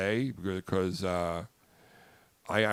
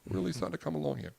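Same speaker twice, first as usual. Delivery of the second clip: higher, quick and softer; light falsetto; 5 semitones higher, 6 dB quieter, much faster than usual. Shown as a demonstration. Host repeats the line in a quick soft falsetto. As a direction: toss the line off high and quick. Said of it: "a high-pitched voice like you guys".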